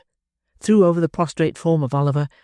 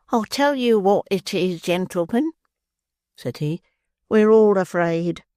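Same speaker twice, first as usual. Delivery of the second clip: slow, higher, resigned admission; philosophical calm; 5 semitones higher, slower than usual. Acted. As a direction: state it calmly, like a sage. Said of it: "most philosophically".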